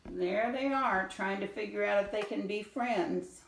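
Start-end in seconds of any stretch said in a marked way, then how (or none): none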